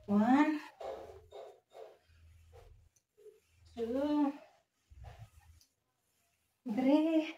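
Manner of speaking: straining while counting reps; doing push ups. Real, not acted. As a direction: count through the effort.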